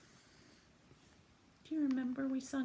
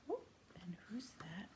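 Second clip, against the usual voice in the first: ordinary talk, nothing marked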